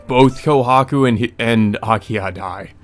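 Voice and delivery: ordinary talk, nothing marked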